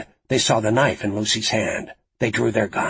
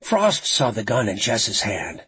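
ordinary delivery, nothing marked

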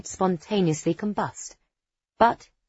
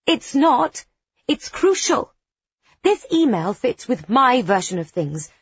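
agitated; 8 semitones higher, 4 dB louder than usual. A narrator's reading. Agitated outburst.

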